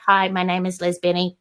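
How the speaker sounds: mock your own stiff early on-camera voice; mild imitation of a nervous, wooden presenter; awkward stiff on camera. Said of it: "Oh, God, that bad".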